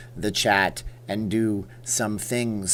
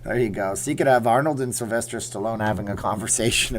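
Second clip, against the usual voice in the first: ordinary talk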